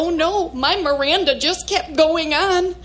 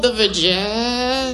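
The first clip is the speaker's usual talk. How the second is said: struggling to say it; 3 semitones lower than usual. Acted. Embarrassed mother trying to name the show, struggling to say it. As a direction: stall and strain on the last word, dragging it out. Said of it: "a little hard time saying that word".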